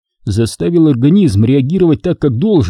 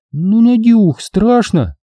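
put-on pleading girl voice; 10 semitones higher, much slower than usual. A narrator's reading. Lilia pleads, voiced character.

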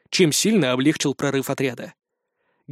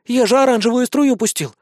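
high, hurt protest; offended; 6 semitones higher than usual. A narrator's reading. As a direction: protest feeling wronged. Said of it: "обиделся Шурик". (обиженно)

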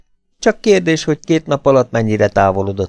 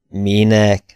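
ordinary delivery

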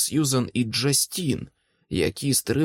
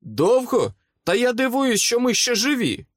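much higher-pitched, heated retort; incredulous exclamation; 12 semitones higher, 3 dB louder than usual. A narrator's reading. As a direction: throw it back in high-pitched disbelief. Exclaimed retort amid battle. (обурений високий вигук)

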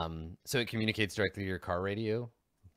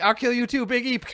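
high, loud mock threat; hammed-up kid threatening in a game; play-fight shouting; 11 dB louder than usual. Boy with pretend gun to newcomer, high mock shouting.

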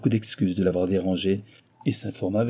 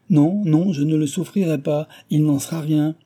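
higher, louder furious refusal; in a fury; 7 semitones higher, 5 dB louder than usual. Acted. Guardian furious at Cécile over the bookseller, loud and high. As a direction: refuse her outright, hot with anger. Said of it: "s'écria-t-il en fureur".

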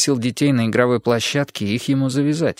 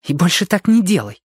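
grumbling; 6 semitones higher than usual. Acted. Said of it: "пробурчала она".

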